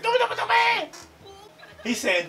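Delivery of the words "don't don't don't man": very high frantic squeal; mock panicked pleading. Reactor imitating the scared guy, high panicked pleading. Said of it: "he's the one that's panicking and screaming".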